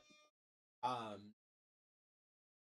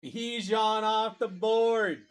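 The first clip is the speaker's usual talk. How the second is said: slow, higher call, last word held long; hype announcer call; 4 semitones higher, 4 dB louder, much slower than usual. Real, not acted. Host announcing Bijan is taken, drawn-out announcer call. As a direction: stretch it out like an arena announcer.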